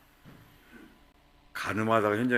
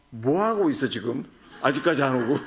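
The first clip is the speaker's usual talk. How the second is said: higher, louder impatient demand; irritated thirties partner; 5 semitones higher, 5 dB louder than usual. Retold or imitated. 늦는 배우자에게 짜증 내며 다그치는 삼십 대. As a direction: snap at a late partner. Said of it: "삼십 대는 뭐라 그래요".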